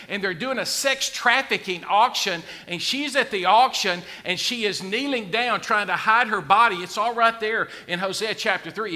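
fiery pulpit preaching; full-force preaching. Acted. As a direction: build the sermon to a fervent climax.

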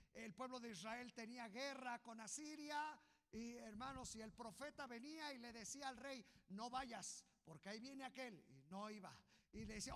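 high, loud storytelling sermon; preacher racing through the story; full-force preaching. Acted. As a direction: tell the battle story with fire.

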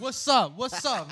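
high loud challenge; taunting call. Retold or imitated. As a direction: provoke the crowd. Taunting fans while walking.

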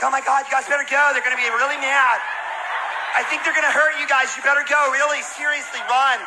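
high urgent warning. Retold or imitated. Girls warning the boys to run, hammed-up worry. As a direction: warn the boys to flee.

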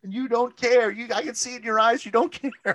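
high, loud mock outrage; pleading fan yell. Mocking a fan pleading with Nick Gage, high and loud. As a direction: beg the wrestler to stop, hammed up. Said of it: "everybody's that way at a Nick Gage show".

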